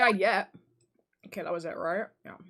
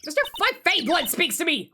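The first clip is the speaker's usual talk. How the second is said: much higher, louder character voice; excited accusation; 8 semitones higher, 6 dB louder than usual. Acted. Assistant accusing the witness to Nick, high and loud. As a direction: point the finger, thrilled.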